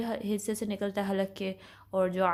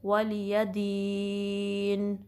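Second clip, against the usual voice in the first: slow recitation; much slower than usual. Shown as a demonstration. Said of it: "normal सा ही पढ़ेंगे".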